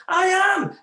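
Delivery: high, loud panicked yell; little brother crying out mid-crash. Retold or imitated. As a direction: squeal it as a frightened kid.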